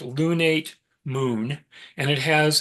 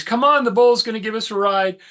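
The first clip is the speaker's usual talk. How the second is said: higher, louder call to friends; acted excited call; 8 semitones higher, 5 dB louder than usual. Europa to her buddies on the bull, mock yell. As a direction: call the buddies over for a ride. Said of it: "she yells to her buddies".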